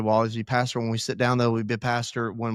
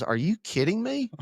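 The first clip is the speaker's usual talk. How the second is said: a hint of the wife, pitched higher in disbelief; wife's disbelief; 10 semitones higher than usual. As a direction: lightly voice the wife, incredulous. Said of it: "And Lindsay goes".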